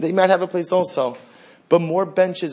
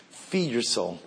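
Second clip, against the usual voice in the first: much softer, almost hushed repeat; hushed plea; 5 semitones lower, 9 dB quieter, slower than usual. Acted. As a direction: let it fall to a near hush.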